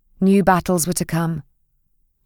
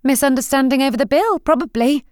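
forced insouciance; 8 semitones higher than usual. Acted. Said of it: "trying to sound insouciant".

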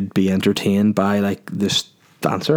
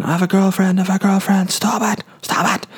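high, pleading mock scream; mock panicked screaming; 10 semitones higher than usual. Mate being smacked on stage, high mock pleading scream. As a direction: imitate a panicked mate begging her to stop. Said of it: "he kept screaming".

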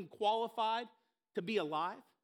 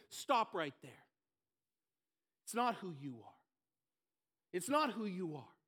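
lower, soft, firm voice of God; 5 semitones lower, 3 dB quieter than usual. Retold or imitated. God gently stopping Moses's excuses, low and firm. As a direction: cut him off, calm and deep.

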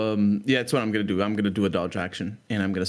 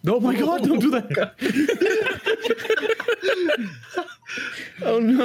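laughing panic. Real, not acted. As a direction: shriek with laughter. Reacting to slime growing.

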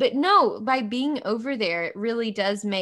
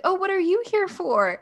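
professor's question, pitched much higher; mimicking the professor; 10 semitones higher than usual. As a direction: put on the lecturer's prim high voice, lightly. Retelling professor singling her out.

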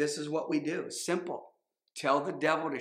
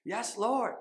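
lightly voiced child, higher and earnest; imitating a small child praying; 6 semitones higher, slower than usual. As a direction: echo the little boy's prayer sweetly. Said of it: "he's just like".

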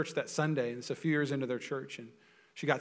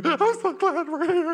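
much higher, slow, syrupy voice; gushing hugger; 8 dB louder, slower than usual. Retold or imitated. Pastor mimicking an overly huggy churchgoer, slow gushing high voice. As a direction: coo it like an overeager churchgoer. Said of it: "she'd be like".

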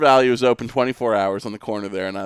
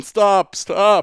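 higher mock yell, not full force; half-volume retold shout; 8 semitones higher, 4 dB louder than usual. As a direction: imitate someone yelling after a runner.

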